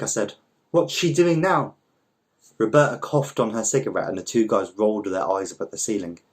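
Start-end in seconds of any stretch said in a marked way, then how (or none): 0.7-1.7 sarcastic. Acted. Twyla reacting sarcastically.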